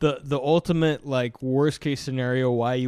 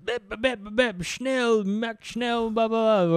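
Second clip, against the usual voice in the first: 高い声でまくしたてるドイツ語もどき; 新兵に命じるドイツ海軍士官のまね、高めに; fake German gibberish; 9 semitones higher, slower than usual. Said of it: "naval officer telling his new guy".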